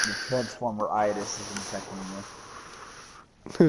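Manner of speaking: slow, drawn-out broken-mic voice; glitchy robot voice. Pretend. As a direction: play a glitching transmission, slowly.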